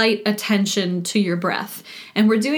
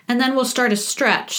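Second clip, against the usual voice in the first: ordinary talk, nothing marked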